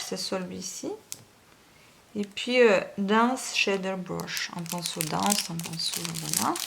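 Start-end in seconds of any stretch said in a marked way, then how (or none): 3.0-4.5 very slow French-accented English; labored English in a French accent; terrible English accent. Real, not acted. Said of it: "mon accent anglais terrible".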